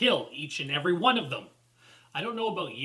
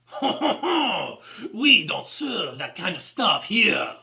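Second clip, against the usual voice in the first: loud, higher, hammed-up French sneer; haughty mock-French laugh, then a drawn-out bad French accent; snotty French accent; 5 semitones higher, 6 dB louder, slower than usual. Reviewer mimics a French clerk refusing a customer, snotty French accent. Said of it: "in a snotty French accent".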